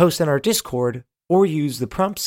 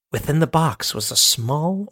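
plain reading voice

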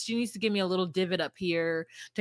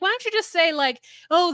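high, loud mock exasperation; exasperated; 7 semitones higher, 7 dB louder than usual. Podcast host second-guessing a clumsy translator, exasperated.

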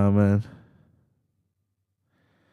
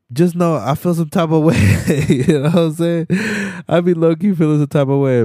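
real laugh, voice pushed high and louder; laughing admission; 7 semitones higher, 4 dB louder than usual. Real, not acted. Admitting he feels some type of way, laughing. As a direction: confess it through laughter, pitched up.

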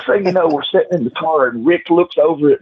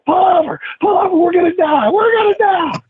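high frantic shout, voice cracking into a cry; panicked screaming. Retold or imitated. Passenger yelling at the driver to pull over, panicked shout. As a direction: scream it like you think the car will crash. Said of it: "starts screaming to the top of his lungs".

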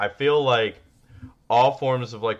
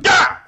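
startled gasp; 12 dB louder than usual. Retold or imitated. Startled by kid sneaking up.

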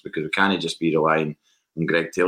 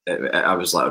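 ordinary delivery

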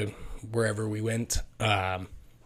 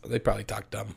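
ordinary talk, nothing marked

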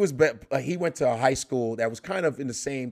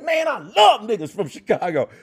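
[name] impression; 8 semitones higher, 6 dB louder than usual. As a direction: do a high, loud [name] voice. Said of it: "He said".

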